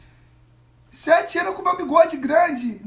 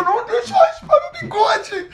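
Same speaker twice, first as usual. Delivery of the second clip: louder pleading through laughter; laughing plea; 5 semitones higher, 6 dB louder than usual. Real, not acted. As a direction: beg while laughing. Begging again after a goal.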